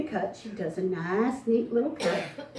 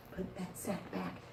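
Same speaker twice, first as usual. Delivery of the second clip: softer, slow, secretive; sneaky hush; 3 semitones lower, 17 dB quieter than usual. Acted. Boys plot to return the sack, hushed.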